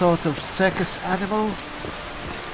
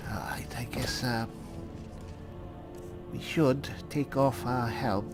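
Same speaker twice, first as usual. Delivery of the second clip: hushed; 6 semitones lower, 11 dB quieter than usual. Pretend. Hushed on entering the palace court.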